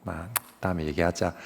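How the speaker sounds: softly conceding; resigned giving up. Retold or imitated. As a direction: sigh and let the argument drop. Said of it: "아마 제 같았으면 그랬을 것 같아요".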